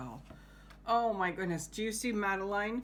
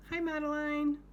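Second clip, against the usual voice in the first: higher, cheerful little hello; high greeting; 8 semitones higher, 3 dB louder, faster than usual. Acted. Teacher greets Madeline on the page in a high voice. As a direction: greet her in a high playful voice.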